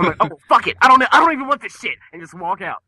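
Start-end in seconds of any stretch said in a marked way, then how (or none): 0.2-2.0 loud, high, fast exasperated blow-up; retold rant, much louder and quicker; fed-up outburst. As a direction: snap and throw it all away in disgust. Retold storming out of McDonald's.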